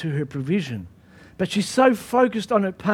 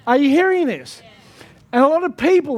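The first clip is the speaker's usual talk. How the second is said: ordinary talk, nothing marked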